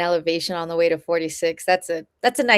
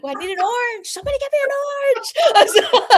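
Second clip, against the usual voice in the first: high pleading whine for an orange; desperate runner; 6 dB louder, much faster than usual. Retold or imitated. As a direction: beg like a starving runner. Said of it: "I was like".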